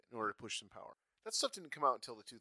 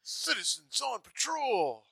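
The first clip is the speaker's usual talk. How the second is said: slow, high, proud shout-out; proud cop announcement; 10 semitones higher, slower than usual. Retold or imitated. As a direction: announce it like a dorky hero. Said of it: "he's like a sweet Chuck".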